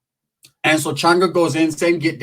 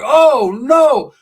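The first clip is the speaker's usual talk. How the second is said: shocked; 9 semitones higher, 5 dB louder than usual. Acted. Shocked reaction.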